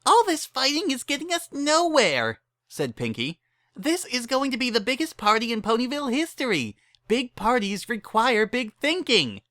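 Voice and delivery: eager Pinkie pitching in; Pinkie Pie voice. Acted. Pinkie urges friends to help, bubbly.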